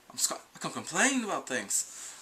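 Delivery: a hint of a Scottish accent, loud start; lightly put-on Scottish Doctor. Vlogger mimicking the new Scottish Doctor, light Scottish accent. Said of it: "he's like".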